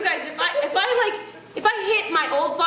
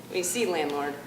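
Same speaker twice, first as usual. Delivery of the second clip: low, soft, patronizing tone; smug condescension; 4 semitones lower, 5 dB quieter than usual. Pretend. Tenant dodging landlord's rent demand, smug and low. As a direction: brush off the landlord smugly. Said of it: "use that logic".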